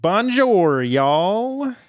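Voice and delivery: loud, high mock French greeting with a twang; hammed-up French accent. Shown as a demonstration. Host showing off to the co-host, joke French accent. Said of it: "That's my best French accent".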